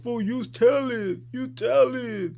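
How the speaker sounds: high, drawn-out whiny mocking; stretched-out crybaby taunt. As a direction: imitate whining commenters, slow and high. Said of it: "crybabies in the motherfucking comments talking about".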